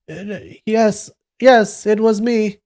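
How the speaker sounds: high-pitched, hesitant put-on voice; flustered young Taoist. A narrator's reading. Impostor covers for the shout, flustered and high.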